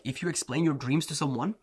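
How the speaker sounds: ordinary delivery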